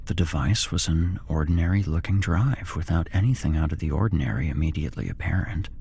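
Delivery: ordinary delivery, nothing marked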